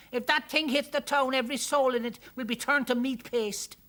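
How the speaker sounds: high, loud Irish alarm; panicked Irish townsperson; wailing. A narrator's reading. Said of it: "somebody wailed".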